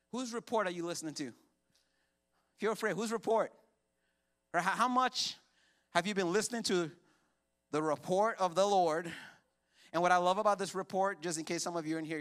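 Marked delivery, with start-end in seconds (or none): 2.6-11.2 full-force preaching. Acted. Pastor preaching to the church, loud and driving. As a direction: preach it hard to the congregation.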